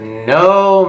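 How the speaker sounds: high, stretched-out "no"; emphatic refusal. Retold or imitated. Teller refusing as date grabs her hand, indignant.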